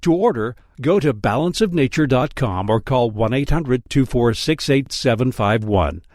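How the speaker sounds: usual speaking voice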